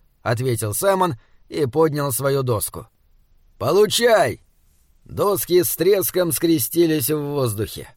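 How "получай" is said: shouted strike, higher and louder; battle cry. A narrator's reading. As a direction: yell it as you swing. Саймон наносит удар. (боевой выкрик)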